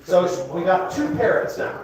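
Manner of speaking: ordinary talk, nothing marked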